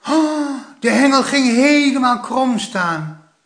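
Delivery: high, loud thrilled strain; straining boy fighting a big fish; thrilled child with a heavy catch; excited boy. A narrator's reading. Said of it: "zei Plop".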